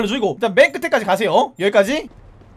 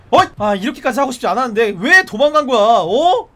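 usual speaking voice

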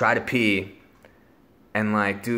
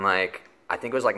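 ordinary talk

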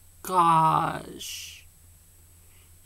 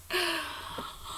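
mock gasp; 12 semitones higher, 5 dB quieter than usual. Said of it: "I was like".

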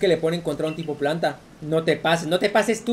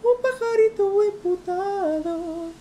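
much higher, slow sing-song teasing the bird; high mocking sing-song; much slower than usual. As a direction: croon at the angry little bird to wind it up. Mock voice for the angry bird.

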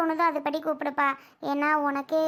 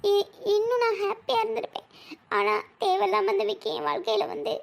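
high-pitched, crying complaint; tearful lament; 5 semitones higher than usual. Acted. Crying bride laments her second marriage to her friend, tearfully. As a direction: pour out your regret through tears. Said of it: "ஏன் டீ இப்படி அழுதுகிட்டே இருக்காஞ்சு".

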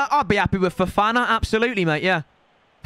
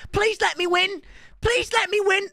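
high, pleading mock voice; mocking whiny opponent; 12 semitones higher than usual. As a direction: imitate the opponent begging. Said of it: "Do you reckon he's messaging me?".

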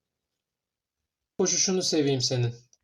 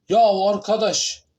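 exasperated shout; 6 semitones higher, 7 dB louder, slower than usual. Real, not acted. Frustrated at Granny trap.

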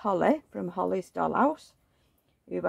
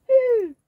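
high coo; 10 dB louder than usual. Acted. Cooing at baby doll.

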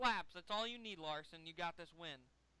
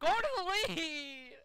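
high, loud, stretched-out yell; real race-fan scream; urgent shout; 8 semitones higher, 7 dB louder than usual. Real, not acted. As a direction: holler it at the driver. Yelling at driver to lead.

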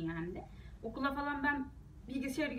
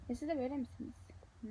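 normal talking voice

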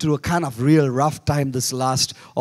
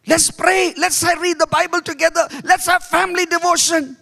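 much higher nagging mom voice; nagging mommy; 13 semitones higher than usual. Retold or imitated. Mother nagging the family to pray, high and insistent. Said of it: "mommy becomes the nag of the house".

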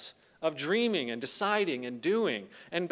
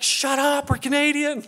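a higher, louder mock-panicked hush; hushing dad; 9 semitones higher, 6 dB louder than usual. Dad shushing his son in the restaurant, mock panic. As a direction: snap at the kid to drop it.

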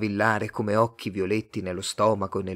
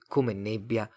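ordinary delivery

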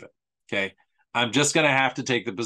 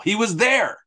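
mock earnest; 8 semitones higher, 4 dB louder than usual. Real, not acted. Sarcastic mock credibility.

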